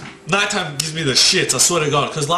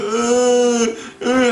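long, higher-pitched eerie moan; 7 semitones higher than usual. Retold or imitated.

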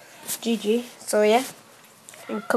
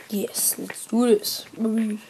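slow excited whisper; breathy slow hype whisper; 5 dB quieter, slower than usual. Pretend. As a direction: psych yourself up in a hush. Hyped whisper before the round.

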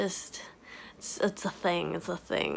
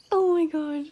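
high squealy excitement; excited; 8 semitones higher, 3 dB louder than usual. Real, not acted. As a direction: squeal with joy. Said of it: "I'm so excited".

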